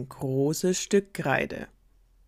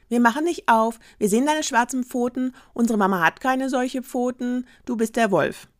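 put-on loud, higher kids calling through the door; kids shouting; 8 semitones higher, 6 dB louder than usual. Kids calling out loud to the wolf at the door. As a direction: shout them off together.

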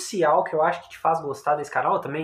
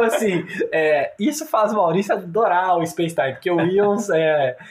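usual speaking voice

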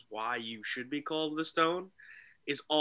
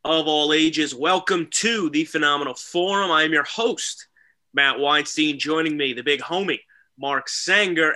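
loud showman's welcome to the crowd; ringmaster; 3 semitones higher, 10 dB louder than usual. Acted. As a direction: announce it like a circus ringmaster. Opens the show like a ringmaster.

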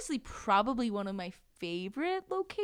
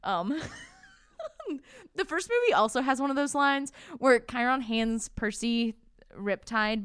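ordinary talk, nothing marked

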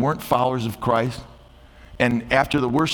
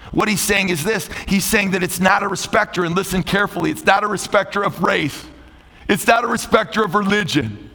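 high-pitched sermon delivery, building higher; impassioned preaching; 8 semitones higher, 3 dB louder than usual. Real, not acted. Pastor telling the congregation grace is for all, impassioned preaching. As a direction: preach it out, climbing in pitch with each point.

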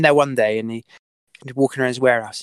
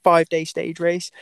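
higher, heated comeback to the boss; retold outburst; 6 semitones higher than usual. Rider snapping back at sponsor about one t-shirt, heated. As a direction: fire back at him in a raised voice. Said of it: "just lost my shit".

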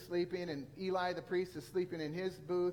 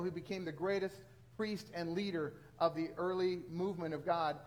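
ordinary talk

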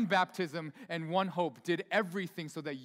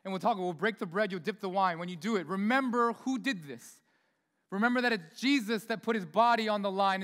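ordinary talk, nothing marked

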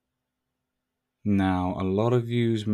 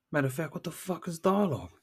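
mock exasperation; 9 semitones higher, 5 dB quieter, faster than usual. Rhetorical outburst in podcast intro.

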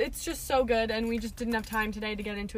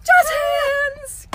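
much higher, loud showbiz shout; jazz hands cheer; 13 dB louder than usual. Pretend. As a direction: belt it like a stage finale.

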